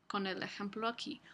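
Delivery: usual speaking voice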